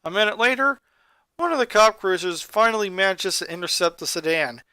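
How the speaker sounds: usual speaking voice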